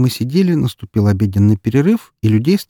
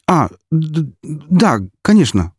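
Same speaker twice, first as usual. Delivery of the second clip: stammering, higher-pitched flustered assent; flustered mumble; 7 semitones higher, slower than usual. A narrator's reading. Intimidated young clerk giving in, flustered stammer. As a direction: stumble over the first words, flustered and eager to comply. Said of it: "пробормотал парнишка".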